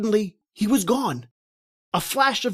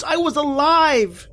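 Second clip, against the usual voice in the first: high, louder burst of joy; joyful cry; 7 semitones higher, 4 dB louder than usual. Acted. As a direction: cry out in relief at being alive. Joyful realisation he survived.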